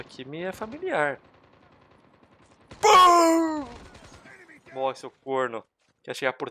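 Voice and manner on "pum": high, loud, long "pum"; cartoonish high boom; imitated gunshot boom. Real, not acted. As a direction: sing out the bang. Imitates shot while firing.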